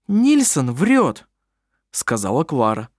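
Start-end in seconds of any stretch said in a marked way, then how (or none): 0.1-1.3 slow, high, curt denial; firm dismissive woman. A narrator's reading. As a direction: shut the lie down. Clara flatly denies.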